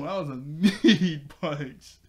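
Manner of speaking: laughing through the words; giggling while saying it. Real, not acted. Laughing at the punch.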